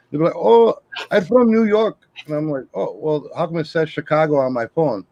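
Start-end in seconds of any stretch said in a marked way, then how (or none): 0.4-2.0 high, drawn-out mock caller voice; imitated spam caller. Spam caller answering where they are from, imitated high and slow. 2.8-5.0 mock-innocent challenge. Acts out challenging the caller.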